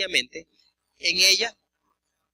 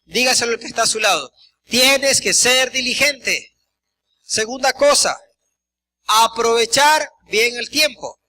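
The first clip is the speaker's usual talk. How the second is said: full-force preaching; 5 dB louder than usual. Acted. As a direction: preach it out to the congregation, hammering every point.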